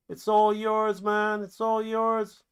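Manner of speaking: high-pitched player calling to a teammate. Acted. Player giving the ball to the kicker, calling out.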